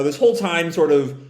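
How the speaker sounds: normal talking voice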